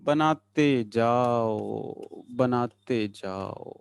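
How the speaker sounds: low, very slow singsong refrain; drawn-out coaxing. Real, not acted. Teacher chanting as students keep drawing, low drawn-out singsong. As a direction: croon it slowly, stretching every vowel.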